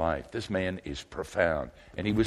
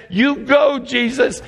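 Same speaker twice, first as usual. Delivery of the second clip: high, loud cheerleader whoop; root for Jesus like a fan in the stands; excited cheer from the crowd; cheering him on; 10 dB louder, slower than usual. Retold or imitated. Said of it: "I've been his cheerleaders".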